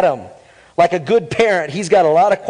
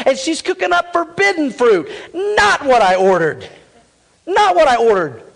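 whining Adam; 7 semitones higher than usual. Retold or imitated. Adam whining to God about Eve, high pitched. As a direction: complain like a wronged husband. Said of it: "Adam's like".

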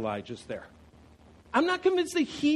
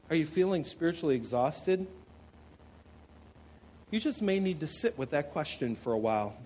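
ordinary talk, nothing marked

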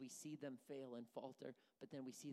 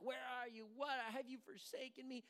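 anguished lament; 10 semitones higher, 4 dB louder than usual. Retold or imitated. Preacher voicing a lament.

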